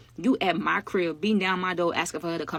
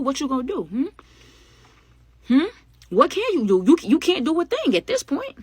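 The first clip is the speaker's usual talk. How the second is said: higher and louder, building; emphatic lecture; 7 semitones higher, 5 dB louder than usual. Real, not acted. Narrator warning viewers about money, rising emphatic. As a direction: drive the point home.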